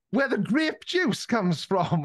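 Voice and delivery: high, slow, wide-eyed question; mock amazed. Cashier asks about grape juice in a mock amazed voice. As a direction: play the cashier as brightly astonished. Cashier mistakes Welsh for Welch.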